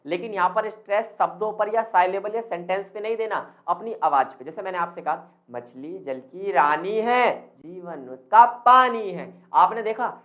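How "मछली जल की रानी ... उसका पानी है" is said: slow sing-song recital, "रानी है" and "पानी है" swung up and down; rising and falling intonation. Shown as a demonstration. Teacher showing intonation with a nursery rhyme, voice swinging on "पानी है". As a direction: recite with exaggerated rise and fall on "रानी है" and "पानी है". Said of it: "मेरी आवाज में कभी ऊपर और कभी नीचे".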